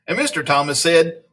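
lively read-aloud with playful bounce on silly words; animated picture-book reading; story narration. A narrator's reading. Reading a silly school story to children, animated storyteller.

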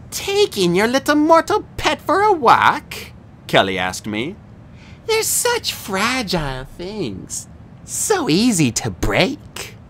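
slow, sneering, high villain voice; menacing monster cheerleader. A narrator's reading. Kelly taunts Percy about his mortal friend, slow and sneering. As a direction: purr the threat, savouring it.

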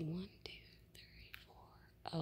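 hushed, unvoiced count; whispered counting. Real, not acted. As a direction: count them quietly under your breath.

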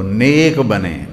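نیک بنے said very slowly, نیک leaned on; slow stress on نیک. Shown as a demonstration. Preacher urges parents that the son be pious, slow heavy stress on نیک. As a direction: draw out نیک بنے as the key point. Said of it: "repeated for emphasis".

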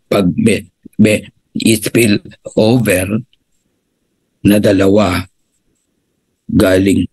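a deliberately boring, stumbling pitch; mock dull presentation. Shown as a demonstration. Coach mocking the presentation members say they want, dull and slow. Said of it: "Ganun ba gusto niyong presentation?".

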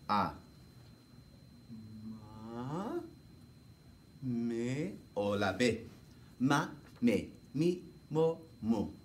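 ordinary delivery

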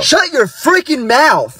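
high, drawn-out angry yell; angry husband outburst. Acted. Husband snaps at wife before officer.

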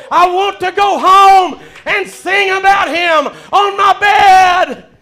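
high, forceful preacher's cry; fervent preaching. Real, not acted. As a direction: declare it to the congregation, building to a shout.

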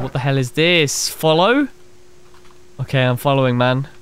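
ordinary delivery

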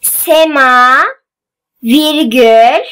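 slow, word by word with long pauses; dictating. Shown as a demonstration. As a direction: read it out slowly for pupils to write down. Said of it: "Ben metni yavaş yavaş okuyacağım".

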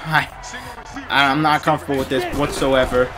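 usual speaking voice

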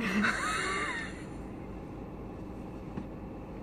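high, breathless laughing fit; high squealing laugh. Real, not acted. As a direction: laugh helplessly, near tears.

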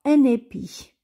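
un épi with the n linked: "un-n-épi"; liaison. Shown as a demonstration. Teacher modelling the liaison "un épi" for a pupil. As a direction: link the n of "un" into "épi". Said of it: "On fait la liaison, on dit".

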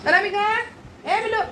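high-pitched call to someone out of sight. Real, not acted. Looking for little Anamika around the house, calling out. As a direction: call the name out high, held at the end.

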